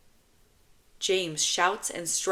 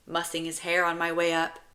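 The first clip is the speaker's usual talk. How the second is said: ordinary delivery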